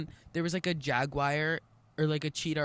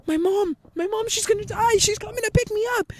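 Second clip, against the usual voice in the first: high, loud panicked wail; shrieky retold panic; freaking out; 5 dB louder than usual. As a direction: re-enact panic for mom at full pitch. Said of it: "freaking out. I was like".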